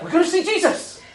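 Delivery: high thrilled cry; excited. Retold or imitated. Wife in a car crash, thrilled high exclamation. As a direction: shout it with pure joy. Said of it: "she was like".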